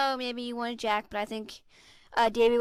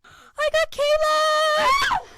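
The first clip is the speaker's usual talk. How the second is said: high, loud, very slow cry of triumph; excited shout; 10 dB louder, much slower than usual. Real, not acted. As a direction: yell it out, thrilled at the draw. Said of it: "people are very loud".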